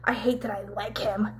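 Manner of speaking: whispered aside to herself; grudging confession in a mock whisper; imitated inner thought. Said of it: "in her mind".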